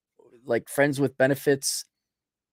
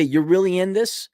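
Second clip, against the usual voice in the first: normal talking voice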